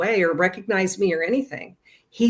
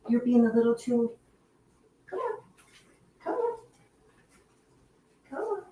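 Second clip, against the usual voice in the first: much higher and softer coaxing call; coaxing the dog; 7 semitones higher, 3 dB quieter than usual. Real, not acted. Owner coaxing her dog away from the camera, high and soft. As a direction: sweet-talk the puppy to come.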